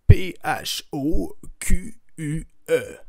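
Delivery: slow spelled answer. Acted. Spelling phoque as fuck.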